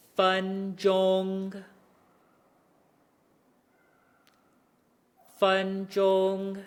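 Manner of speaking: "分钟" slow, both syllables held level high; practicing tones. Shown as a demonstration. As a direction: hold "分钟" flat on one high pitch. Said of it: "Our first word".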